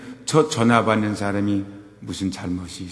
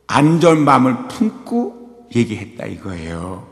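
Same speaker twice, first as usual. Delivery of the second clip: usual speaking voice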